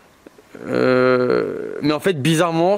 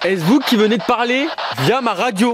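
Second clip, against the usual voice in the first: nettement plus aigu et un peu plus fort; appel aux esprits; 8 semitones higher than usual. Real, not acted. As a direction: lancer l'appel vers la présence, voix haute et portée. Appelle un esprit par radio. (voix aiguë et portée pour inviter l'esprit à répondre)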